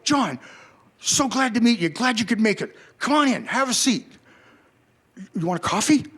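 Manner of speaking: a star welcoming a visitor with delight; Gord's eager welcome. Retold or imitated. Gord greeting him warmly.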